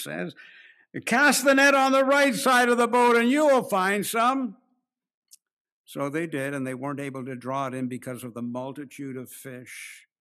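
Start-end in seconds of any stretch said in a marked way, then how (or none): 1.0-4.6 much higher, louder call across the water. Acted. Jesus calls from the shore to the fishermen, loud call. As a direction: call the command out to the boat.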